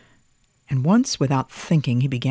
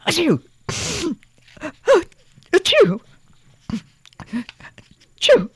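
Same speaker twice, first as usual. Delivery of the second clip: high, loud mock sneezes; sneezing; 5 dB louder than usual. A narrator's reading. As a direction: sneeze three times. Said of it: "Thomas sneezed three times".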